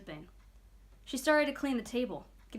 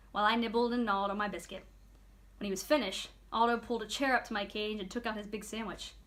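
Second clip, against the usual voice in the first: usual speaking voice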